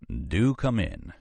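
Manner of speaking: slow, inviting Southern drawl. Acted. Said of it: "in his most inviting southern voice".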